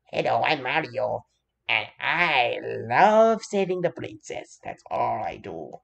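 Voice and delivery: a lightly voiced, slow Mario take; Luigi's mild attempt at his brother's voice; Mario impression by Luigi. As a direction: do a half-hearted Mario. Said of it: "my Mario impression".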